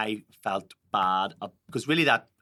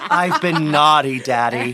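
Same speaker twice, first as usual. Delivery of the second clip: high, loud, slow mock seduction; mock flirty; 5 semitones higher, 13 dB louder, slower than usual. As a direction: camp it up, playfully saucy. Mock flirty joke after spank me.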